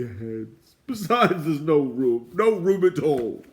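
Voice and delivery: high, loud, whiny lament; sickly doorkeeper begging the dwarf, loud high moan; moaning plea. A narrator's reading. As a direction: moan it pitifully. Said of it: "he moaned".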